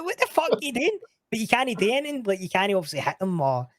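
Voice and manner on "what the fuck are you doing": high-pitched incredulous cry at the thieves; outraged disbelief. Retold or imitated. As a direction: squeak it out in mock outrage. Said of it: "I'm like".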